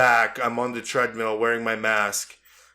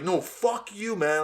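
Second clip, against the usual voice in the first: retold angry yell; 8 semitones higher, slower than usual. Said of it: "we were yelling at each other".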